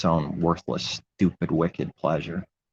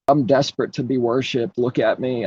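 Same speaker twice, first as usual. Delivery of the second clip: higher-pitched mock begging for attention; high, needy parody with a mocking laugh; mock attention seeker; 6 semitones higher than usual. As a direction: play a desperate show-off, pitched up, ending in a laugh.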